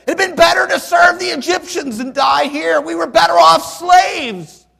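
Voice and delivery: Israelites yelling they were better off slaves; bitter complaint. Retold or imitated. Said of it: "That's what they say".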